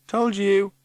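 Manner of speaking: high, bright told-you chime; high sing-song gloat; chiming in. Acted. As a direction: pipe up triumphantly. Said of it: "chimes Teggy".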